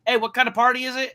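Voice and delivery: high, quick acted question; imitating piqued curiosity; sudden interest. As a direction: perk up and ask fast. Acts out sudden interest in party.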